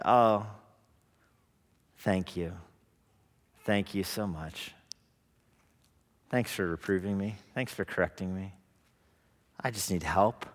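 humble gratitude. Retold or imitated. Grateful wise man voiced softly.

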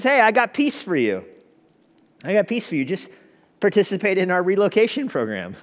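lightly voiced slick salesman, high; a hint of a pushy timeshare seller; salesman pitch. Preacher mocks the envoy as a relocation salesman, a light pitch.

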